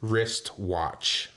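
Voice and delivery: slowly spelled out, word by word; slow demo. Shown as a demonstration. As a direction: say it slowly, carefully, as a model. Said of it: "This is from one of our listeners".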